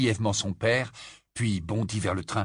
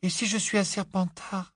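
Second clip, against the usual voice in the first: put-on anxious boy; 9 semitones higher than usual. A narrator's reading.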